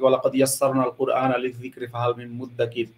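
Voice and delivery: soft, low Quranic recitation in Arabic; quiet recitation of an Arabic verse. Retold or imitated. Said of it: "কোরআনে অবশ্য আছে এরকম কথা".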